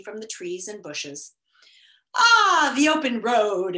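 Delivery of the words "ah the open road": a big loud "Ah" of delight; exclaiming. A narrator's reading. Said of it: "exclaimed the humbug, breathing deeply".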